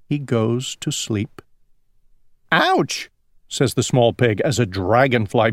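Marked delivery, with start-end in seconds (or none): 2.5-3.1 high-pitched little ouch; mock pained exclamation; pained yelp. A narrator's reading. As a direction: yelp it high, like a storybook pig bumped on the nose. Pig bumped by a dragonfly.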